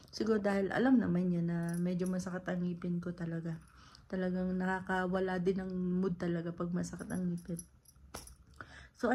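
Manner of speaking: ordinary delivery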